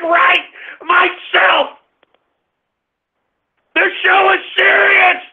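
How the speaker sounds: high-pitched furious rant at callers; angry rant. Acted. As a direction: yell at them, fed up. Host ranting at his callers.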